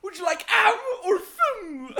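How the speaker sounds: high, loud hammed-up English accent; laughing posh English accent. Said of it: "Oh yeah, English".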